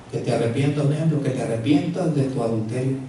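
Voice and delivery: low, soft solemn voice; prophetic word. Acted. God speaking through a prophet to the adulterer, low and soft. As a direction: speak it softly as God's warning.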